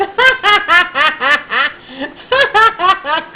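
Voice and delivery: loud cackling laugh; big laugh. Real, not acted. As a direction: burst out laughing hard. Laughing after yell.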